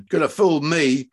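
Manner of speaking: plain reading voice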